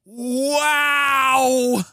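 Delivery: building amazement. Retold or imitated. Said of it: "you're like".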